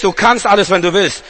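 ordinary talk, nothing marked

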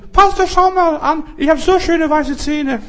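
high, falsetto imitation of a delighted woman; woman's voice. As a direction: squeak it out like a proud old lady showing off her teeth. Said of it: "Und dann sagt sie".

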